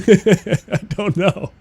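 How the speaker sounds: slow, high "I don't know" through laughter; laughing. Real, not acted. Streamer cracking up at her own creaming soda rant, laughing slowly. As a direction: dissolve into giggles on "I don't know".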